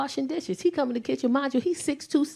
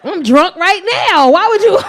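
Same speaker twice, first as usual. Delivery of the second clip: put-on laughing drunk mom; 7 semitones higher, 11 dB louder than usual. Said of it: "I said".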